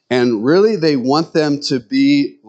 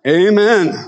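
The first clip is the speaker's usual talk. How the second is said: loud, higher amen; mock amen; 7 semitones higher, 4 dB louder than usual. Women agreeing men have thick skulls, loud amen. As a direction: call out amen like a church lady. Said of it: "women would say".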